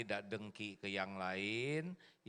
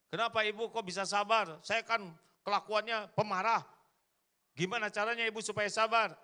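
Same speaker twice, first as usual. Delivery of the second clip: curious husband; 10 semitones higher, 6 dB louder than usual. Acted. Husband asks wife, acted quote.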